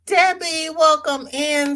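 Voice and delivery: welcoming Debbie to the stream cheerfully; cheerful welcome. Real, not acted. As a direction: call a friend in happily. Welcoming a viewer.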